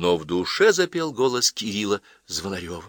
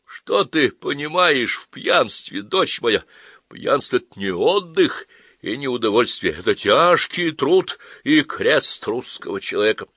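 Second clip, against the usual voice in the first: slow, raised, grandiose speech; drunken father orating; 5 semitones higher, 5 dB louder, slower than usual. Acted. Father explains drinking to his daughter, orating. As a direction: declaim it with drawn-out stresses.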